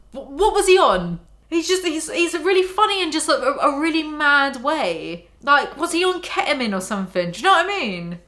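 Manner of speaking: ordinary delivery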